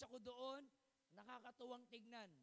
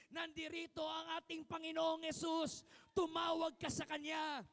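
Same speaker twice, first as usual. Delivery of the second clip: loud, driving sermon delivery, louder than his teaching voice; full-force preaching; 5 semitones higher, 12 dB louder than usual. Acted. Pastor rousing the congregation to cry to Jesus, preaching loud. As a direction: pound each line out to the hall.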